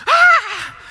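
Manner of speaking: a sudden high shriek of fright; startled wife's scream while throwing the axe at the snake; mock scream. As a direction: shriek as she swings the axe. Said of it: "She's like".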